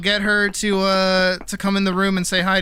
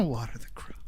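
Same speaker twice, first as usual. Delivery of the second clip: whisper; 5 semitones lower, 11 dB quieter than usual. Real, not acted. Whispered aside.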